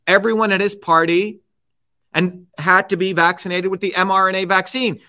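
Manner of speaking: usual speaking voice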